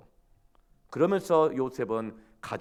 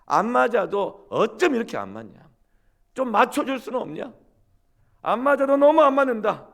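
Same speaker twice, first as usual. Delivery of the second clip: much higher, loud angry yelling; husband yelling; 11 semitones higher, 9 dB louder than usual. Retold or imitated. Gyeongsang husband yelling at his wife in a fight. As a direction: shout your frustration at your wife. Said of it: "소리를 지르면서 싸웠어요".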